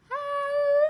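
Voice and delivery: a squeaky hello way up high like a flute. Shown as a demonstration. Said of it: "as high as we can, like a flute".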